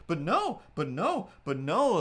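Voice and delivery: mock flustered protest. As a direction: imitate someone stuck protesting, high and insistent. Greeter's acted repeated protest.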